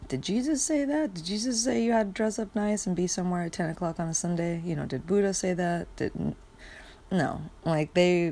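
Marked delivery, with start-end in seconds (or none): 0.1-2.5 sharp, much higher, disbelieving question; incredulous. Real, not acted. Mocking church rules to her viewers, incredulous high question. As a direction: scoff at the idea, pitched way up.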